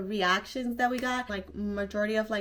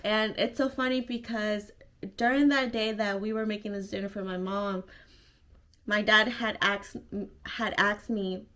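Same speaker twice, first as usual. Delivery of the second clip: normal talking voice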